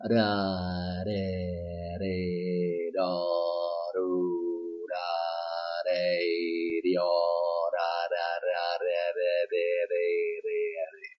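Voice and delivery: quick tapped r syllables "ra, re, do"; tapped r drills. Shown as a demonstration. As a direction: drill the tongue tap slowly, syllable by syllable. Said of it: "pronounced in the following manner".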